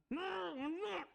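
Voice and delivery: high, muffled around the regulator; mouthpiece in mouth. Retold or imitated. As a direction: talk through the dive regulator. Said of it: "I had this in my mouth".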